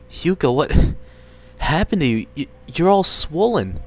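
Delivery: horrified at what you see; alarmed. Acted. Sibling finding Yuka swollen, loud and alarmed. Finds sister swollen in bed.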